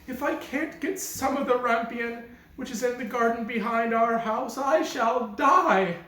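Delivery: a sick wife begging, sure she will die; pining wife. Acted. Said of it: "she replied".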